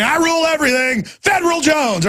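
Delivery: strut and declare yourself ruler; mock boast. Host pretending to be Federal Jones, pompous high shout. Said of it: "I just walk around going".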